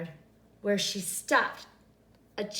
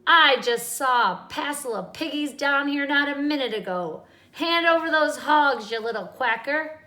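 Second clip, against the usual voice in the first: angry witch; 5 semitones higher, 7 dB louder than usual. A narrator's reading. Witch demands the pigs.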